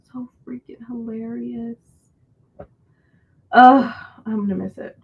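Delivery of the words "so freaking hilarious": breathy whispered aside. Real, not acted. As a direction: say it under your breath.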